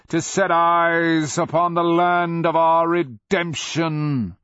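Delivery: loud, high, drawn-out declamation; exultant beast. A narrator's reading. Dragon glorying in being first, loud exultant proclamation. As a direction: proclaim it slowly, rising to a shout.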